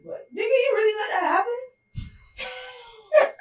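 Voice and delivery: cracking, incredulous and high; mock-scold yourself in disbelief; disbelieving self-scold. Scolding himself over the breakup.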